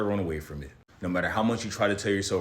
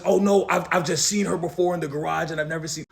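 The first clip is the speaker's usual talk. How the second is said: flustered neighbor; 7 semitones higher than usual. Retold or imitated. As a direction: squeak out a flustered excuse. Voicing neighbor's flustered excuse.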